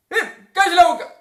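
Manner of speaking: high, explosive telling-off; scolding shout. Acted. Owner scolding a dog behaving badly, shouting. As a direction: shout sharply as at a misbehaving dog. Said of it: "retando un perro".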